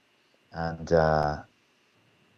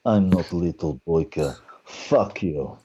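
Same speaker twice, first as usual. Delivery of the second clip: slow, menacing fighter character voice; put-on tough-guy voice, deliberate and defiant; Boyka; slower than usual. Actor doing his fighter character on request, menacing. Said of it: "Can we hear a little boiker?".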